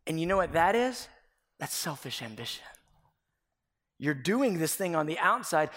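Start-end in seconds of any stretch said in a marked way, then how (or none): none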